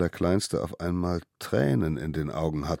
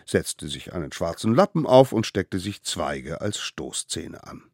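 ordinary delivery

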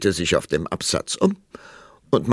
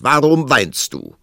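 loud, raised demanding question; calling out; 8 semitones higher, 9 dB louder than usual. A narrator's reading. King to his weeping wife, calling out loudly. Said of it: "rief er aus".

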